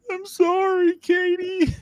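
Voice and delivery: put-on very high, childish apology; whiny kid voice. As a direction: squeak out a kid's sorry. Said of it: "And I'm like".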